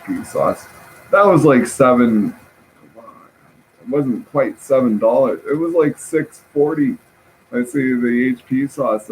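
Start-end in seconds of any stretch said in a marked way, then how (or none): none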